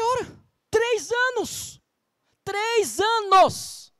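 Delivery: high, very slow disbelieving repeat; incredulous scoff. Real, not acted. Pastor mocking a mother's excuse to the congregation, incredulous and slow. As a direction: drag it out in disbelief.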